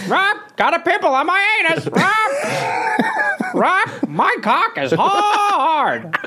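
parrot squawk. Pretend. Caged parrot on the porch squawking crude lines, high parrot squawk.

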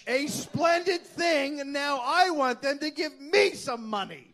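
high, loud proclaiming Charles. Retold or imitated. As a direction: address the courtroom grandly.

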